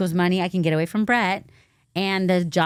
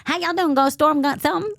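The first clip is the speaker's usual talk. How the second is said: high, exaggerated Southern greeting; Southern accent; 8 semitones higher, faster than usual. Shown as a demonstration. Host imitating folks back home in a Southern accent. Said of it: "when people talk in Southern accents".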